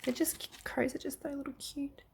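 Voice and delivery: soft, voiceless cooing aside; whispered aside. Real, not acted. Reviewer gushing over how cute the crows are, whispered. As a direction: whisper it under your breath, adoringly.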